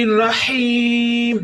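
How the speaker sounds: الرحیم with a throat ha, slow; throaty ha. Shown as a demonstration. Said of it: "ہا کی آواز پھر حلق سے نکالیں گے". (الرحیم میں حلق سے ہا)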